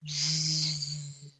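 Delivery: low, soft bee buzz. A narrator's reading. Narrator imitates the angry bee swarm, low buzz.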